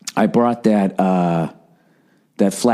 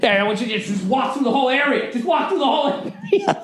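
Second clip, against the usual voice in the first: high, sarcastic mock yell; imitated freak-out; 13 semitones higher than usual. Friend to people passing through the tailgate spot, imitated freaking out. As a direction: mimic a friend losing it over intruders. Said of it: "Like, freaking the fuck out".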